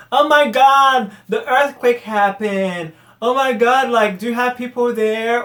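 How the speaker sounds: loud, high mock shock; exaggerated high-pitched condescending concern; condescending mimicry. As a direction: imitate gushing pity, drawn out and overblown. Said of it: "so condescending".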